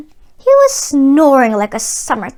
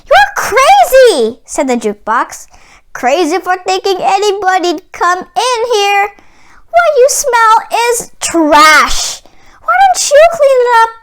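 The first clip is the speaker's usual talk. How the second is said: higher, cheeky talking jukebox; higher sassy jukebox character voice; 6 semitones higher, 3 dB louder than usual. Acted. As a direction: tease the giant as a mouthy jukebox. The jukebox answers the giant.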